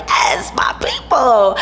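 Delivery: slow, breathy whispered yes; whisper-cheer in triumph; mock secret celebration; hushed cheer. Celebrating getting the wristband.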